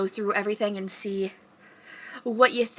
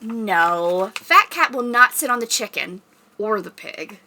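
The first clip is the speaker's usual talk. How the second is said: louder, emphatic storybook no; reading to children; 5 dB louder, slower than usual. Pretend. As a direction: tell the kids firmly but playfully. Reading picture book aloud.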